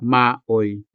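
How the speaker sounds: maoy said as "maoɪ" in two syllables; Hiligaynon word maoy. Shown as a demonstration. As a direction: model maoy for learners. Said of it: "for example, the word".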